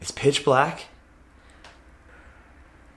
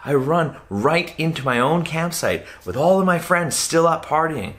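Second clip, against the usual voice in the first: normal talking voice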